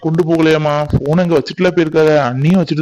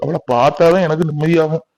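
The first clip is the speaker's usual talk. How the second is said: normal talking voice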